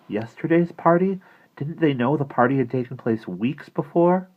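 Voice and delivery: high, loud incredulous question; puzzled disbelief. A narrator's reading. Maggie wonders at the doll's mistake, high bewildered voice.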